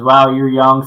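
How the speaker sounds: usual speaking voice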